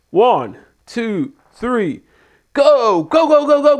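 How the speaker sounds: excited countdown. Retold or imitated. Wrestler counting down before stunt.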